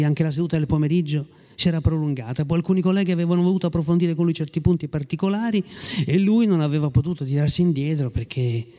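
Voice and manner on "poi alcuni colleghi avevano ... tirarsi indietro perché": plain reading voice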